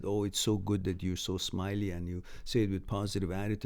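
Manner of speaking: usual speaking voice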